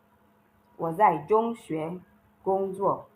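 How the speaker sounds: slow, clear, each syllable apart; slow model sentence. Shown as a demonstration.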